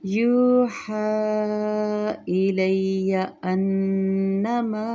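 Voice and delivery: slow melodic tajweed chant; Quran recitation. Shown as a demonstration. Recites verse.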